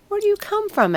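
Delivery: the mice pipe up, full of curiosity; curious mice. A narrator's reading. Curious mice question the tree.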